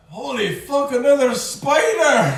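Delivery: high, loud, alarmed cry; alarmed shout. Real, not acted. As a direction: blurt it in alarm. Player sees another spider.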